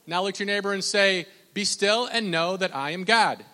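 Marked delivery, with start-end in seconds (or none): 1.5-3.4 loud, higher scripture call; emphatic pulpit proclamation; preach. Acted. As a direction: lead the congregation in declaring it. Leads congregation in verse.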